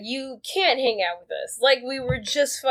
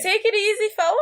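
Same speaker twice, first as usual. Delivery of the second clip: lightly voiced, higher, teasing guy; mock high-voiced fella; 9 semitones higher, faster than usual. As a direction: tease him in a silly higher voice. Mock reply voiced in high register.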